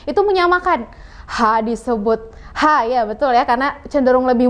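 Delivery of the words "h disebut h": ordinary delivery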